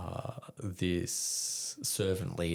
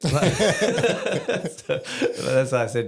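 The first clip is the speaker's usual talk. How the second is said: laughing; 8 semitones higher, 8 dB louder, much slower than usual. Real, not acted. Laughs at own punchline.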